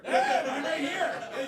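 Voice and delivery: hammed-up singer impression, loud at first. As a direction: do a comic celebrity diva impression. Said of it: "I'll stop doing [name]".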